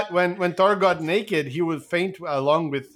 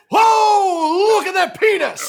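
mock astonished shout; 14 semitones higher, 7 dB louder, slower than usual. Acting Zeus seeing Thor naked.

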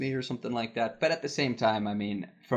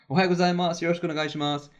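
higher, louder polite Japanese greeting; professional greeting voice; 7 semitones higher, 4 dB louder, much faster than usual. Retold or imitated. Guest greets assistant director on arrival, professional greeting voice. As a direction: put on your best professional Japanese greeting voice. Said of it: "in my best Japanese Aisatsu professional voice".

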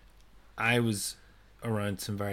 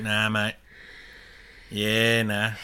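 mock Australian accent; Aussie slang; slower than usual. Playing up Aussie disagreement, mock Aussie accent. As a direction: toss it off like a broad Aussie. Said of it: "how, like, an Aussie".

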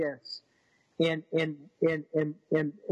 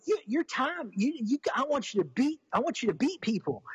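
put-on much higher, childlike insistence; voicing his daughter; 7 semitones higher than usual. Dad imitates his daughter insisting he beat people, in a childlike voice. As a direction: mimic the kid's earnest logic.

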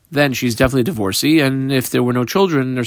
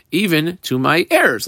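ordinary delivery

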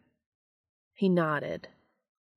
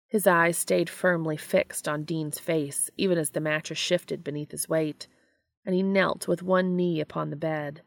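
ordinary delivery, nothing marked

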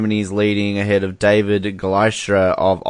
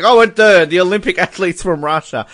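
mock commentator; 7 semitones higher than usual. Mocking Olympic commentators, in a hammed-up announcer voice. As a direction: parody a stiff announcer dodging the word Russian. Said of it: "they have to be like".

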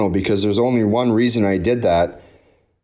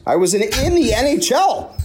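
excited; 12 semitones higher than usual. Real, not acted. Amazed at cheering for rivals.

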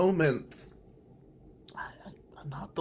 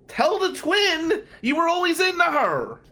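loud punchline; 13 semitones higher, 5 dB louder than usual. Retold or imitated. Punchline advice to friend.

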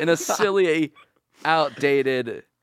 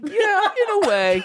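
laughing; 4 dB louder, slower than usual. Real, not acted. Laughing reply.

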